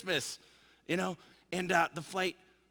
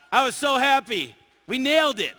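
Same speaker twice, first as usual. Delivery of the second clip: high, loud burst of excitement; triumphant joy; 7 semitones higher, 9 dB louder than usual. Retold or imitated. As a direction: celebrate the win at full voice. Retelling the call center celebration.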